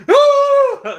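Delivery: shrill high-pitched fake scream; mock scream. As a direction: shriek like a startled kid. Will walks in on mom.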